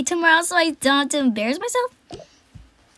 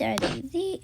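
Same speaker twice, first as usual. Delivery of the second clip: whisper; 6 dB quieter than usual. Pretend. Whispering near sleeping teddy.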